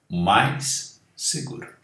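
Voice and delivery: normal talking voice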